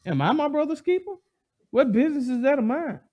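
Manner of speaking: high, cocky mock Cain; boastful brush-off. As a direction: toss it off like it's none of his business. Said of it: "He's boastfully saying".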